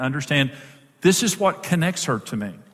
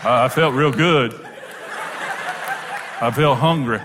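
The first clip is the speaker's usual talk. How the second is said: gruff hunter. Retold or imitated. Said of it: "The table of men over here says".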